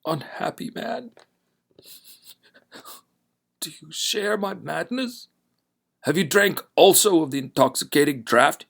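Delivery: a tormented man pleading, rising from whisper to outcry; despairing stranger, whisper building to a loud, high plea; broken-voiced stranger. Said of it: "he spoke, in broken accents".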